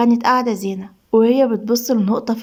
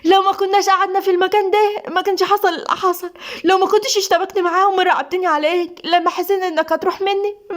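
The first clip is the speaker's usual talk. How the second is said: high-pitched, furious accusation; fierce exasperation; 7 semitones higher than usual. Acted. Wife demanding divorce from husband, fierce and enraged. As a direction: lash out in a raised, fierce pitch. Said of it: "زينة بغيظ وشراسة".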